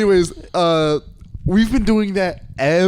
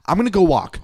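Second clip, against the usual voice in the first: higher, indignant announcement of leaving; mock-huffy, pitched up as you walk away; imitating an upset friend's exit; worked-up storming off; 7 semitones higher than usual. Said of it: "You're not having it. You go".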